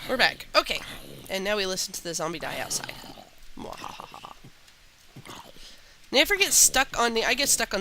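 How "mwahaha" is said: breathy whispered evil cackle; sneering panto villain. Acted. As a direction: gloat like a cartoon villain. Gloating as zombie dies.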